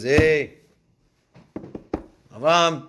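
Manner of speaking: divine call. Acted. God calls Abraham.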